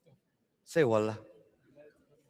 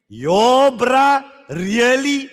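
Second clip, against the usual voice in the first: drawn-out, slow "bruh, really?" of disbelief; disappointed inner voice; 3 semitones higher, 5 dB louder, much slower than usual. Retold or imitated. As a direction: let them hear your let-down in their head. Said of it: "you hear my voice in your head saying".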